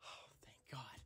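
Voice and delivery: relieved breathy whisper. Real, not acted. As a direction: breathe it out softly. Relieved aside.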